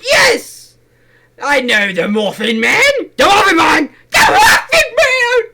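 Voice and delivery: loud, high, hysterical yelling; hysterical shouting. Acted. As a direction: scream it out in delight. Acting Gingerbread Man confession, Shrek.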